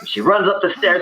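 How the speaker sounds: normal talking voice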